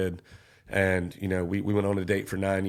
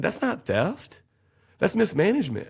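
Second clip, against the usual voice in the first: much higher, sharp rebuttal; indignant retort; 10 semitones higher than usual. Retold or imitated. Defendant rebutting the accuser before the jury, high and indignant. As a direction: snap back the correction, incredulous. Arguing his case to grand jury.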